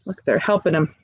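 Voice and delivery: ordinary delivery, nothing marked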